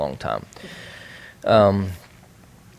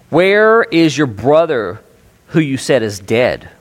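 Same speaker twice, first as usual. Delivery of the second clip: louder and higher, slow demand; 5 semitones higher, 9 dB louder, slower than usual. Retold or imitated. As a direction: press them hard for an answer. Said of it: "He demanded".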